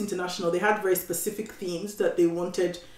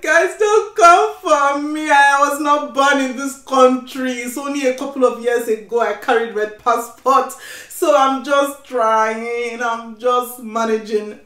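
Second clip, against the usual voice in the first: loud, high, playful protest; drawn-out comic self-defence; mock pleading; 5 semitones higher, 7 dB louder, slower than usual. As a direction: plead for leniency, hamming it up. Mock plea excusing own accent.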